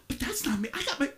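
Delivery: high, defensive whine of denial; a high mock protest of innocence; mock indignant protest. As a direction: play the offended churchgoer. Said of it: "Some of you are like".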